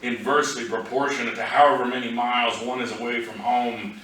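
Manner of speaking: projected spoken-verse delivery; poetry reading. Acted. Poet reads a road-trip poem to an audience, recital voice. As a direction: recite in measured phrases, lifted above casual talk.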